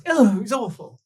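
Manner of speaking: ordinary talk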